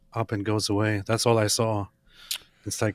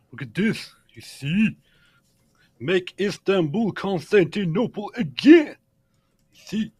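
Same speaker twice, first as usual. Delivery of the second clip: proud show-off; 8 semitones higher, 4 dB louder than usual. Real, not acted. Showing off a novelty sweater.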